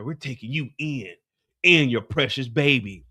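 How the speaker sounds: usual speaking voice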